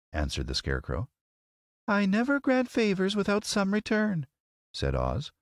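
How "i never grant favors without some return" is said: very high, regal lady; firm terms in a high lady's voice. A narrator's reading. Lady wizard refusing free favours, regal.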